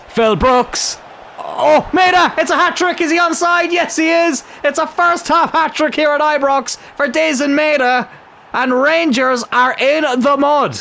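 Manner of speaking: high-pitched goal call, carried right through; excited goal commentary. Pretend. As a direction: call the hat-trick like a TV commentator.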